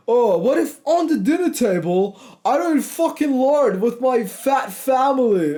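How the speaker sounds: high, sneering mimic of a weak excuse; mocking whiny voice. Ranting coach mocks a viewer's fear of the family dinner, sneering and high. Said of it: "stop worrying about".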